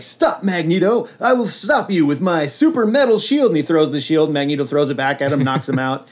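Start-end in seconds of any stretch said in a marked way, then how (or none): 0.0-3.5 heroic Captain America. Retold or imitated. Hero challenges the villain, mock heroic. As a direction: proclaim it like a cartoon hero. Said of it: "He's like".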